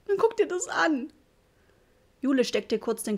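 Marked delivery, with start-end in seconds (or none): 0.1-1.1 high sobbing, voice straining. A narrator's reading. Mother sobbing to her family about the wallpaper, high sobbing. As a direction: cry it out, much higher. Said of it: "schluchzte sie".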